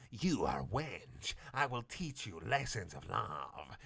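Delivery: soft, very slow threatening villain voice; quiet hammed-up villain threat; menacing villain. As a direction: menace her slowly. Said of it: "yelled Video Santiago with fist".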